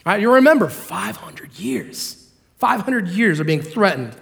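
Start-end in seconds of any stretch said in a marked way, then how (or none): 0.7-2.2 hushed, slow whisper; breathy hushed aside; whispered emphasis. Acted. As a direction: drop to a whisper on it. Preacher dropping to emphasize.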